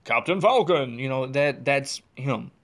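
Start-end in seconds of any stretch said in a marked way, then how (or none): 0.0-1.0 a light take on a booming game announcer, slow on the first name; imitating the announcer. Imitating Xander calling fighter names, lightly announcer-voiced. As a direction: call out the names like a tournament host, lightly. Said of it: "that's his voice".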